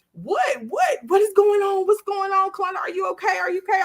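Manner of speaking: high, urgent overlapping questions; frantic questioning. Retold or imitated. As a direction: act out a frantic crowd firing questions. Acted crowd crowding her cell.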